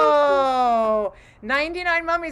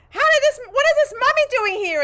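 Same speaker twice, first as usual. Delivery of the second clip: high, indignant comic outburst; outraged bartender; 12 semitones higher, 5 dB louder than usual. Acted. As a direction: demand an explanation from the bouncer. Bartender confronts bouncer about mummy.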